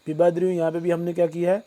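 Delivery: ordinary talk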